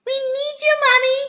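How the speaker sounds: put-on high, loud calling child; shouting. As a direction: call out for help. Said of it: "shouted Peppa".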